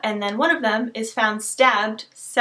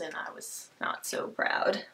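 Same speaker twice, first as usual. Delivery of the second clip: whisper; 4 semitones lower, 12 dB quieter than usual. Real, not acted. Deflated after real world remark.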